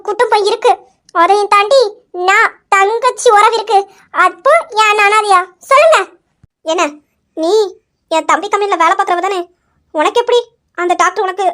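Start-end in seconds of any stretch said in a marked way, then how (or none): 5.7-6.0 sharp loud high demand; furious demand to the aunt; angry demand. Acted. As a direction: snap it out high and hard, demanding an answer. Angry confrontation, demanding answer.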